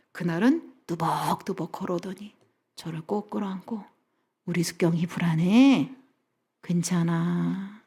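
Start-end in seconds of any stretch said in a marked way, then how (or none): 4.5-7.8 slow, gentle reassurance; tender soothing. Retold or imitated. Husband hugging his anxious wife, tender soothing. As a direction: soothe her as a loving husband would.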